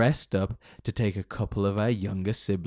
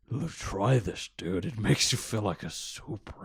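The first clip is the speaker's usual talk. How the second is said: lightly voiced Batman; 2 semitones lower, 5 dB quieter than usual. Voicing Batman offering weed.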